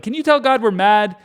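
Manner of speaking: mock the grumbling people; hungry Israelites nagging Moses, hammed-up whine; whiny complaining crowd. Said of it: "they're always like".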